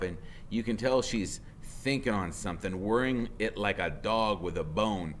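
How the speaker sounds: plain reading voice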